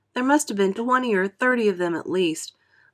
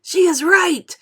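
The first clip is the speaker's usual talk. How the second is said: much higher, louder frightened shout; 11 semitones higher, 7 dB louder, slower than usual. A narrator's reading. Ladybird shouting over the panic. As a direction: yell agreement in panic. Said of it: "shouted the ladybird".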